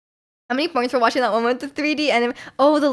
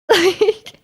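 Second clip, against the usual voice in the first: laughing; 4 semitones higher, 4 dB louder, slower than usual. Real, not acted. Laughs at own example.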